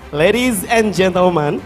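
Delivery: ordinary talk, nothing marked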